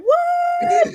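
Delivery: high laughing shouted what; shocked squeal. Retold or imitated. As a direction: squeal it in stunned disbelief. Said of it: "I was sitting there like".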